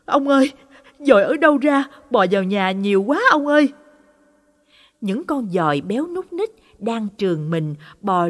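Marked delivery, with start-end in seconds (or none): 0.0-3.7 high, loud cry calling to her husband; panicked yell. Acted. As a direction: shout for help, rushing the end. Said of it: "rồi hét lên lần nữa". (hét gọi chồng hoảng loạn)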